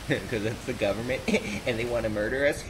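laughing between the phrases; giggling. Real, not acted. Gamer explaining his joke about the government, real giggling. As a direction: crack up at your own joke while talking.

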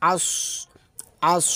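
আস with a thick, heavy স; heavy sad. Shown as a demonstration. Teacher demonstrates the sad letter. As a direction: say আস full and dark in the mouth. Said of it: "মোটাভাবে উচ্চারণ হবে".

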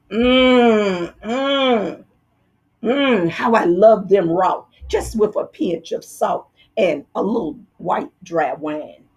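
savoring hums, lazily murmured; dreamy relish. Acted. Woozy fox dreaming of eating the mice, savoring. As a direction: drool over the thought of food.

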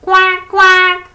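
very high, loud quacking; duck quack. Acted. The duck calls to the spider, loud high quacks. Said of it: "called the duck".

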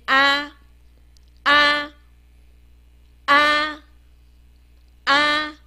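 啊 as a long, level, open "ah"; 字母发音示范. Shown as a demonstration. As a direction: hold 啊 steady on one pitch, repeat after pauses. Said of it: "我们念啊". (平稳拉长地念“啊”)

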